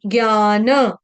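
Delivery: ज्ञान drawn out as "gyaa-na"; full final na. Shown as a demonstration. Teacher correcting clipped endings, ज्ञान said fully as "gyaa-na". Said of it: "ज्ञान को ज्ञान पूरा ऐसे पढ़ेंगे".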